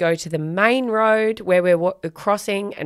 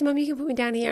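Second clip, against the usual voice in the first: put-on child's voice; 8 semitones higher, 5 dB quieter, much faster than usual. Daughter voiced in story.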